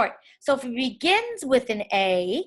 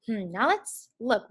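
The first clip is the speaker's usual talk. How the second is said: ordinary delivery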